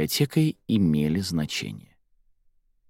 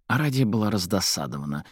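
usual speaking voice

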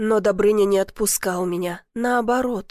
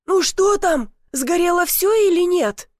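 higher and louder, shouting from outside; calling out; 7 semitones higher, 5 dB louder than usual. A narrator's reading. Neighbour Semyon Petrovich shouting in from outside, anxious call. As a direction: call in through the window.

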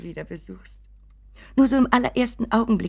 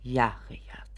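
a soft whispered yes; whisper, defeated; meek whisper; 8 semitones lower, 9 dB quieter than usual. Acted. Mother answers her son's reproach, meek whisper.

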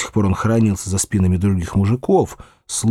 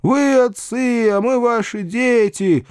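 slow, high-pitched drawn-out wailing; long, high imploring cries; peasant chorus wail; 12 semitones higher, slower than usual. A narrator's reading. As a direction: wail it out like a pleading crowd. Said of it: "завопили вдруг мужики целым хором".

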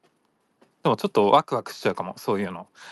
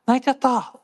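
high, tiny cutesy voice; Chiikawa voice; 12 semitones higher, 4 dB louder than usual. Retold or imitated. Helpless captive sobs like Chiikawa, high tiny voice. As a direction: say it like a small helpless mascot. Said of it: "ちいかわのように".

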